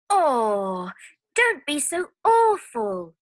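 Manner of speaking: high, loud cry of complaint; high-pitched cry of dismay. Acted. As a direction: protest like a splashed girl. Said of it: "she says".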